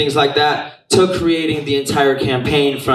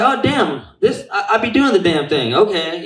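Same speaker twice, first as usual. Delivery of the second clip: excited; 6 semitones higher than usual. Retold or imitated. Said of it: "I'm just like".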